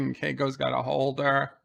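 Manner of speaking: normal talking voice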